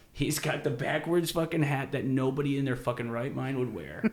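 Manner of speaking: ordinary delivery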